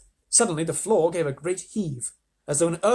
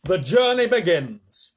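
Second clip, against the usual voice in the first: grand announcement; 3 semitones higher, 7 dB louder, slower than usual. A narrator's reading. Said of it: "shouted the centipede".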